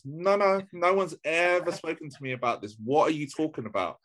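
high-pitched retold protest; indignant disbelief. Protesting to cousin that nobody told them, incredulous.

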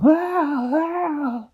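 high frightened puppy yelps; little dogs crying out in fear; scared puppy cries. A narrator's reading. Pups clinging to monkey hair, crying.

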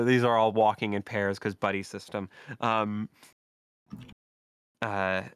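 ordinary talk, nothing marked

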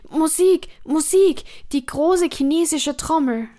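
high, loud panicked call for music; desperate cry. Acted. Dying emperor cries out for music against the ghosts, loud and desperate. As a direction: shout it to drown out the voices. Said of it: "rief er".

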